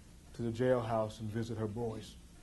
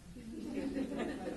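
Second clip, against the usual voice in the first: a short real laugh, higher and soft; 12 semitones higher, 7 dB quieter than usual. Real, not acted. To the audience, a short embarrassed real laugh. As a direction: laugh at yourself.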